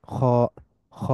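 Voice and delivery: খ between "khaa" and "kho"; heavy Arabic "kh" on খ, half-rounded vowel; correct heavy খ. Shown as a demonstration. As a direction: round খ partly, keeping it heavy. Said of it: "একেবারে খ না".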